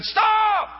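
sudden high shout to halt the cashier. Retold or imitated. Pastor stopping the cashier at the register, shouting. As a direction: yell stop, loud and high.

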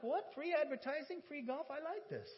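thrilled at a freebie; surprised and eager; excited. Retold or imitated. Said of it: "I'm like".

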